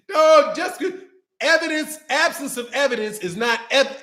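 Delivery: usual speaking voice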